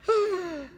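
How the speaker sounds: sharp high gasp; a sudden startled gasp; shocked gasp. A narrator's reading. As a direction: gasp at the sight. Family sees the hair.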